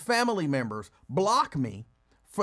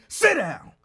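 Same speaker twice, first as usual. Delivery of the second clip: higher, louder bark of an order; screamed command; 5 semitones higher, 7 dB louder than usual. Shown as a demonstration. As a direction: holler it at the dog as a demo. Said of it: "You ain't gotta scream at me".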